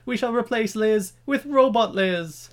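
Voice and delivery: high, loud ceremonial announcement; mock proclamation. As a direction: proclaim it like a pompous royal decree. Acted royal proclamation about robot queen.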